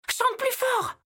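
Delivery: shouted high over the noise; shouting. Acted. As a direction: yell it over the roaring machines. Leila shouts over machines.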